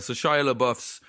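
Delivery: ordinary delivery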